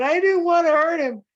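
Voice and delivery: high, loud anguished plea; distraught. Acted. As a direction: protest desperately, near tears. Lenny distraught after the fight.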